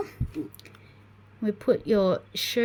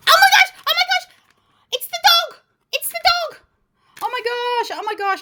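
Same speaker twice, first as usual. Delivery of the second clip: shrieking with joy, much higher and louder; excited screaming; 14 semitones higher, 6 dB louder than usual. Real, not acted. Unboxing the wanted dog figure, real excited screaming. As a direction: scream with delight at finding the dog. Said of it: "I actually hurt my throat doing that".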